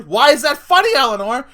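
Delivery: loud, high shouted question; angry shout. Retold or imitated. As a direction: yell it high at the giggling daughter. Angry mom grilling her daughter.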